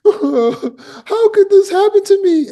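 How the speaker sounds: high, slow hammed-up self-pity; mock indignant whine. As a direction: wail like the world has wronged you.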